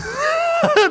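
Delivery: sudden high-pitched laugh; high burst of laughter. Real, not acted. Fan laughing at an unknown opponent's name, high laugh. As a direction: laugh out in disbelief.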